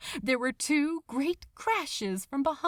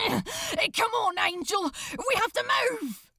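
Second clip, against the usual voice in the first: urgent call; 10 semitones higher than usual. Acted. Crowley yells to Aziraphale as the ship rises, urgent. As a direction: shout it out, pulling him along. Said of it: "He was up in a heartbeat".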